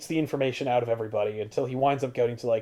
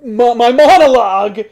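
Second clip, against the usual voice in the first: villain voice; 7 semitones higher, 6 dB louder than usual. Retold or imitated. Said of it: "So he's like".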